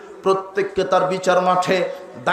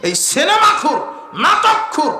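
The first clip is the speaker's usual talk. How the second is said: high, forceful preacher's shout; shouted, high-pitched condemnation; fierce denunciation; 7 semitones higher than usual. Real, not acted. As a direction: hurl each word out as an accusation. Denouncing film and drama addicts.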